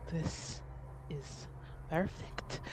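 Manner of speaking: very soft, word by word; quietly pleased. Acted. Ade walks up to Buccio, quiet and deliberate. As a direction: let each word out separately, softly. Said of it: "just like".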